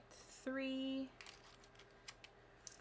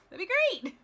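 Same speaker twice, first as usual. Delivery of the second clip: laughing it out, higher; 9 semitones higher, 4 dB louder than usual. Real, not acted. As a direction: say it through a laugh. Repeats with laugh.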